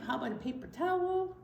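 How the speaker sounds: ordinary delivery, nothing marked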